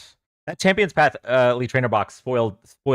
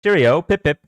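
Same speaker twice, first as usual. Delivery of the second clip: put-on louder, higher British accent; hammed-up upper-class British send-off; mock posh British; 5 semitones higher, 5 dB louder than usual. Streamer sneaking one more joke accent for chat, mock British. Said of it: "no more accents".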